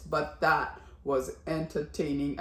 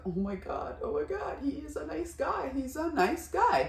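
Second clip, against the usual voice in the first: soft, high-pitched gushing; hushed high swoon; touched amazement; 9 semitones higher, 4 dB quieter than usual. Retold or imitated. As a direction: retell being won over, voice lifting high. Acting out own moved reaction.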